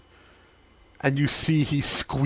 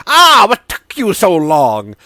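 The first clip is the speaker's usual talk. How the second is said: higher, louder impatient greeting; Sidon NPC voice; 7 semitones higher, 11 dB louder than usual. Acted. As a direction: tease the late hero.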